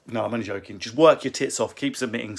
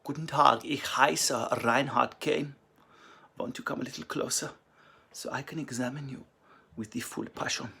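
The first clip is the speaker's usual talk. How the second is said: German accent; 6 dB quieter than usual. Shown as a demonstration. Comedian asked by fan to try German, soft German accent. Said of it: "Try German".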